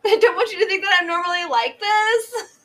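a put-on voice that breaks into laughter; laughing mock plea. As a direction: play the awkward girl while cracking up. Mock texter voice while laughing.

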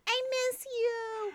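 put-on high, childlike plea; high child voice of students pleading; whiny kids voice. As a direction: beg like sad little kids. Voicing students again.

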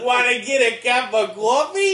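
hammed-up fake Boston accent; mock a bad Boston voice actor. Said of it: "the worst Boston accent".

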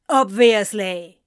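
loud spat word. A narrator's reading. Anthony spitting the word back at Ezra, loud. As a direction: spit it over the shoulder. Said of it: "Anthony spat over his shoulder".

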